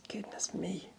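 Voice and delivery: breathy whispered surprise; whispering. Real, not acted. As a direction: whisper it in mock shock. Whispered reaction to cat's weight.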